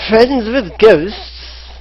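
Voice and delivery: high, slow shout in a bad Scottish accent; hammy Scottish dwarf shouting; terrible Scottish accent. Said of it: "That was a terrible Scottish accent".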